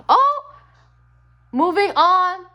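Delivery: high, loud sudden burst; excited exclaim. Real, not acted. Reading the card aloud, a high loud excited burst. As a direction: blurt it out, thrilled.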